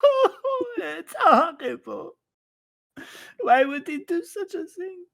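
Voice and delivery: exaggerated fake crying, squeaky and loud; hammed-up bawling. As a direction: blubber it like a melodramatic mourner. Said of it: "I start bawling".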